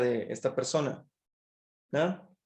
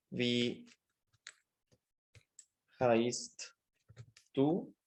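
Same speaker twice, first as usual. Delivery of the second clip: dicting slowly with long pauses; palabra por palabra; 2 semitones lower, 5 dB quieter, much slower than usual. Shown as a demonstration. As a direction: say each word separately, as if writing it out. Escribe la pregunta palabra por palabra. (palabra por palabra, con pausas largas)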